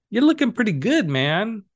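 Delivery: high, loud pep-talk compliment; upbeat self-praise. Pretend. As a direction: hype yourself up like a buddy. Cheering himself on in self-talk.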